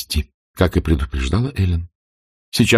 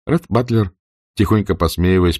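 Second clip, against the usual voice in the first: plain reading voice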